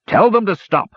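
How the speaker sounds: high, loud command; ordering. Acted. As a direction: bark the order at him. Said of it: "ordered the officer".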